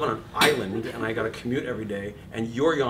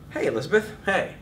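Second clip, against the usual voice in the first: confident greeting; 7 semitones higher, 3 dB louder than usual. Retold or imitated. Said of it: "fantastically confident, relaxed conversation".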